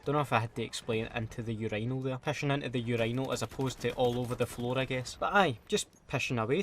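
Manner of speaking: usual speaking voice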